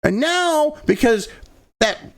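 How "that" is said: ordinary talk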